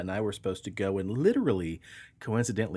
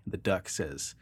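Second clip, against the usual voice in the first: usual speaking voice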